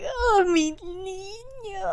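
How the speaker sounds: high, slow, cooing mom voice; doting mother. Retold or imitated. Coworker gushing about her grown son, slow high coo. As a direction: melt over her son.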